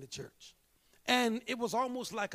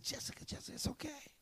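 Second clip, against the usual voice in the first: hushed, soothing voice; very soft, half-whispered consoling; gentle reassurance; 8 semitones higher, 11 dB quieter, faster than usual. Retold or imitated. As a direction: softly comfort someone upset. Retold comforting of upset woman.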